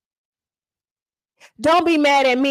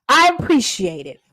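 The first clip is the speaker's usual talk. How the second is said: ordinary talk, nothing marked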